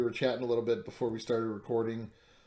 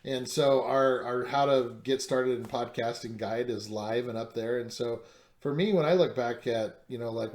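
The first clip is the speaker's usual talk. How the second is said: normal talking voice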